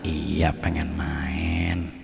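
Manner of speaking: soft, slow, breathy whisper of a shy guest; a timid visitor whispering that he just came to visit; meek whisper. Acted. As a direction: barely breathe the words, slowly. Guest answers the host meekly.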